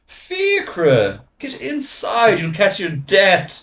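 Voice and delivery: posh British mummy calling out, high and drawn-out; shrill-pitched, slow British parent's call; British accent. Acted. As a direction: call a child indoors in an exaggerated British accent. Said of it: "yummy mummies and daddies with the British accent".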